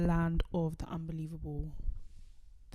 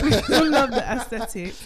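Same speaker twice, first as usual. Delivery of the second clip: laughing loud through words; 6 semitones higher, 12 dB louder than usual. Real, not acted. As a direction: laugh while saying it. Laughing at friend's joke.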